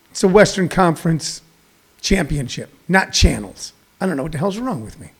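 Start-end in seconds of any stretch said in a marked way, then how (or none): none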